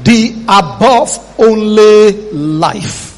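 high, slow, soaring preacher voice; preaching at full force. Acted. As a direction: build the sermon with fire.

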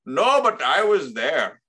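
Raj. Retold or imitated. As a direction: protest in a drawn-out Indian accent impression. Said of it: "that accent has been canceled".

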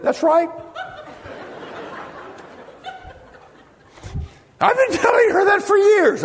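high, excited husband voice; husband siding with preacher, eager. Retold or imitated. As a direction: pounce on the chance to agree.

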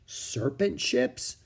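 slow, rising surprised question. A narrator's reading. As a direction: ask in disbelief.

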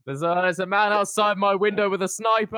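ordinary talk

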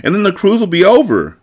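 put-on high-pitched childish whine; whiny. A narrator's reading. Jordan laments the cruise ending, whining. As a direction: whine about time running out. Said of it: "sounding whiny".

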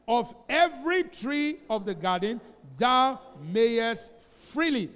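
loud, slow, measured recitation of scripture; scripture reading. Real, not acted. Pastor leading the church in reading Genesis, loud and slow. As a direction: lead the whole church through the verse. Repeats the verse with congregation.

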